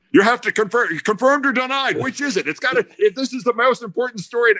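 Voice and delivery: high-pitched mock yelling, urgent and insistent; retold yelling. Twelve reporters all yelling at Dave, mock clamor. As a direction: imitate a pack of reporters yelling at someone. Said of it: "all twelve of us start yelling at Dave".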